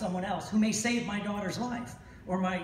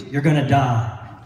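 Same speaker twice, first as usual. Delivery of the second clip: mock news doom; 5 semitones lower, 12 dB louder, slower than usual. Mocking the news.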